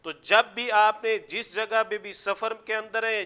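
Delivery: normal talking voice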